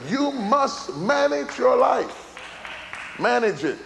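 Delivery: emphatic, high-pitched preacher climbing to a straining shout; full-force preaching. Real, not acted. Preacher urging listeners not to quit on life, impassioned shout. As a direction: drive each exhortation home with rising fervour.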